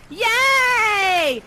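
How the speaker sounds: high, bright yell of "yay"; mock cheer. To the betrayed kids, a mock cheer.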